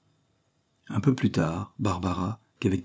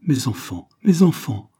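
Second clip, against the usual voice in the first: anguished appeal, jumping higher on the repeat; a narrator's aching mother, swelling from restrained to louder; pained sigh; 6 semitones higher, 5 dB louder than usual. A narrator's reading. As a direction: plead with painful effort, stronger the second time. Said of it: "soupira-t-elle avec un douloureux effort".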